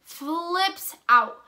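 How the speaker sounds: big dramatic emphasis on "Flips out"; emphatic repeat. Real, not acted. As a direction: punch and draw out both FLIPS and OUT.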